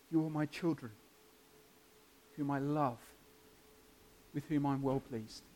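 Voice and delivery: soft, hushed fatherly assurance; God's voice. Acted. God assuring those trusting in Jesus, softly spoken. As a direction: quietly declare it, pausing between phrases. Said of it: "He can say that of you too".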